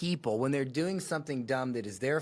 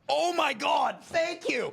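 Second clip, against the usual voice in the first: much higher, louder real outburst of relief; excited cheer; 13 semitones higher, 5 dB louder, slower than usual. Real, not acted. Reacting to a video, cheering that she finally admits it. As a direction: blurt it out in delighted disbelief.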